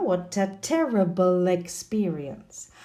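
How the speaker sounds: slow, exaggerated "What a terrible experience" with the words joined; linked words. Shown as a demonstration. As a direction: draw it out, running What into a. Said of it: "on the linking".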